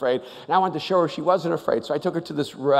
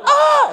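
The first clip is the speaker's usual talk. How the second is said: high imitated scream from the ride; distant scream; 8 dB louder than usual. Imitating riders screaming up ahead, high scream. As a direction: let out a sudden high scream.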